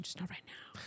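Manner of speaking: unvoiced whisper, very soft; whispered joke tacked on to an excited remark; whispered aside. Real, not acted. Teasing aside to partner.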